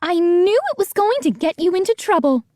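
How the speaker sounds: high scolding housekeeper voice; voiced housekeeper. A narrator's reading. Housekeeper scolds the boy, high and scolding.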